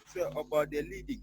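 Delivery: faint and distant; too soft to follow; barely audible. Real, not acted. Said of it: "Again, your voice is low".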